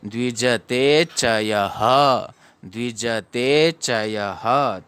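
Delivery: द्विजते च यह slow, final ह fully voiced; full ह ending. Shown as a demonstration. Teacher modelling the line-end visarga, slow and full. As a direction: draw out द्विजते च यह, ending on a whole "ha". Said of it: "यहाँ पर ह पूरा पढ़ेंगे".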